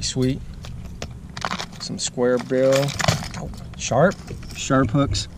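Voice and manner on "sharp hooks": ordinary talk, nothing marked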